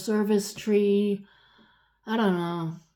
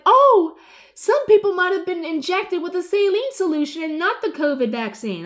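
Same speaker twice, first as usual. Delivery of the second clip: mock-innocent official, much higher and louder; 9 semitones higher, 5 dB louder than usual. Woman mocking officials backpedaling, sarcastic high voice. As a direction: ham up their excuse with sarcasm.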